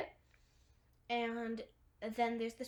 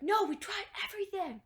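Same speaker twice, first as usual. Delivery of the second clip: louder, higher exasperated retort; super mad; 9 semitones higher, 6 dB louder than usual. Retold or imitated. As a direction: act out being fed up, voice jumping up. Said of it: "he's super mad at".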